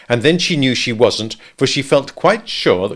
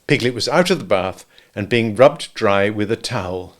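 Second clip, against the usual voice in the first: plain reading voice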